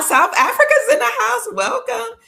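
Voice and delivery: high-pitched, delighted, with a laugh; excited squeal. Real, not acted. Host thrilled to see Cape Town join, excited high greeting. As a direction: squeal the welcome happily. Greeting viewers joining the livestream.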